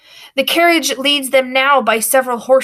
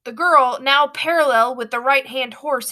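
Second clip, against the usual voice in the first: usual speaking voice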